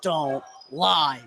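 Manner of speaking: live basketball call, building as the shot goes up; play-by-play. Real, not acted. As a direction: call the action as it happens.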